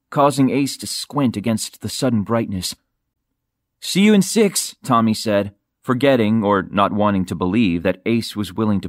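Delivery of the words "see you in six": higher-pitched send-off to a diver; calling out. A narrator's reading. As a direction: call it out as a parting shout, mildly. Tommy calls to diver before dive.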